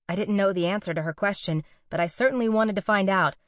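usual speaking voice

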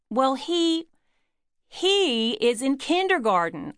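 barked retort. A narrator's reading. Wife to husband about her young son, barked. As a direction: snap it out in exasperated protest. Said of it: "I barked".

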